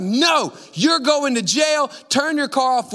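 high, forceful, slowed-down orders; angry officer. Retold or imitated. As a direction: lay down the law as a furious cop. Reenacts officer refusing and ordering.

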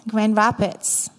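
ordinary talk

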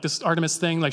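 ordinary delivery

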